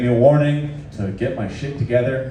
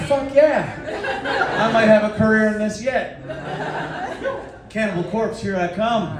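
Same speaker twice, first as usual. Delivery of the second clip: high, laughing, cocky glee; gleeful mock pride; 10 semitones higher than usual. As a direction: crow about the screaming with a laugh. Gleeful reply to police.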